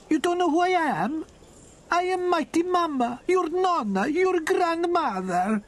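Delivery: put-on very high joyful old lady voice; excited Italian grandmother. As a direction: greet him like a doting nonna. Grandmother introduces herself.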